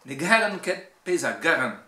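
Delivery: Breton verse with strong Cornouaille stresses; emphatic Cornouaille Breton recitation; Cornouaille Breton accent. Shown as a demonstration. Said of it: "l'accent cornouaillais".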